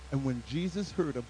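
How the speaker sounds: usual speaking voice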